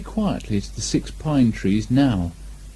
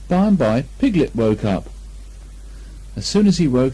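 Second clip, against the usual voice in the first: ordinary delivery, nothing marked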